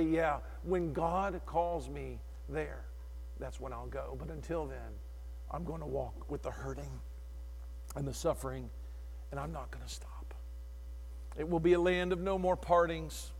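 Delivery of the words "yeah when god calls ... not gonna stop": quiet resolve. Retold or imitated. Preacher answering the devil, low and hushed. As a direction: say it softly, steady and certain. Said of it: "And I say".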